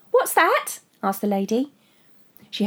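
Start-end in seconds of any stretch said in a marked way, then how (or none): 0.1-0.8 chirpy question. Acted. Chirpy caller.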